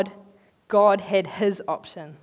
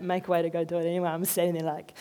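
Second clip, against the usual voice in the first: ordinary talk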